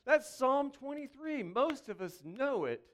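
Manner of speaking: ordinary talk, nothing marked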